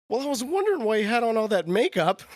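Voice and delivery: high, innocent put-on lady voice; imitating the fan. Fan explaining her mix-up to the actor, high mock voice. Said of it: "And she goes".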